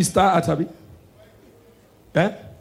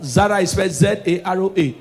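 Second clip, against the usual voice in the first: usual speaking voice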